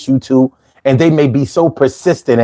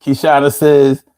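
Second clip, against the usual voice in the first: ordinary talk, nothing marked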